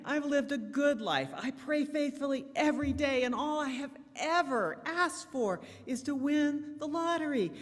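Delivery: high, loud, desperate plea to God; pleading prayer. Acted. Old Goldman on his knees begging God, high and pleading. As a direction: beg God like a frustrated old man.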